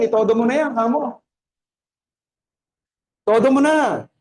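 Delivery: loud, high, urging exhortation; fervent preaching. Acted. Preacher urging the congregation to give their all, loud and high. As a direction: push the congregation to give everything.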